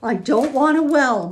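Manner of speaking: loud, high, distraught outburst; acted tearful wail; sobbing protest. A narrator's reading. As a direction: cry out in upset protest. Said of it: "Ma sobbed".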